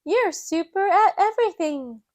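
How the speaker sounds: put-on high child voice praising Miss Rabbit; Peppa. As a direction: gush like an impressed little girl. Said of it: "Peppa says".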